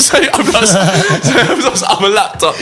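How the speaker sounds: high laughing, slowed right down. Real, not acted. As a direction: laugh through the quote. Laughing retelling mate's reply.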